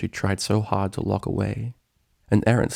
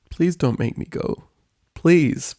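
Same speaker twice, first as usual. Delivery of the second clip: high tearful pleading; 8 semitones higher, 3 dB louder than usual. A narrator's reading. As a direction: beg through tears. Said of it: "Spike pleaded".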